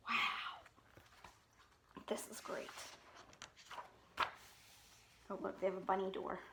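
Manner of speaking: soft whispered wonder. Real, not acted. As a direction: whisper it in awe. Reader's whispered asides.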